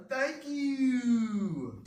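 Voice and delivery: high, loud, hammed-up thank you; an over-the-top cheerful yelp; mock excited shout. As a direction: blurt it like a game show host. Ending the thanks, jokey outburst.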